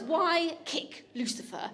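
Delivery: slow, spelled-out "Why kick Lucifer?" with a laugh; slow demo of magic words. Shown as a demonstration. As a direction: teach the guests the phrase, word by word. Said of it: "I'm gonna say it's".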